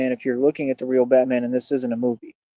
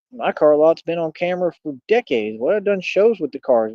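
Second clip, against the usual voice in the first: normal talking voice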